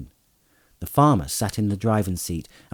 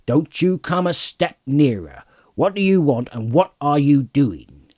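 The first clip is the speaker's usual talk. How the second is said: high, forceful rustic demand; stern challenge; 5 semitones higher, 4 dB louder than usual. A narrator's reading. Farmer confronting a cloaked rider, stern challenge. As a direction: warn the stranger off.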